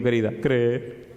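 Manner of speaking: slow, amused punchline; laughing. Real, not acted. The pastor telling the professor simply to believe, amused. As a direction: deliver it gently through laughter.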